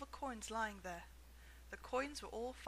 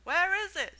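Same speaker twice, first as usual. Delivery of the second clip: high loud shout from the crowd; 8 semitones higher, 12 dB louder than usual. A narrator's reading. Someone in the crowd shouting to see the ticket, loud. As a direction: call out over the crowd. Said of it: "somebody shouted".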